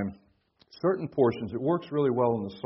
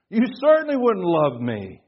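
acted incredulity; 6 semitones higher, 8 dB louder than usual. Acted disbelief at visitors in jail.

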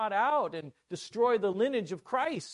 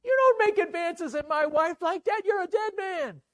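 high, full-voiced outraged shout; explosive acted fury, pitched way up; furious king; 12 semitones higher, 10 dB louder than usual. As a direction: roar it as a jealous king. King acted catching Haman with wife.

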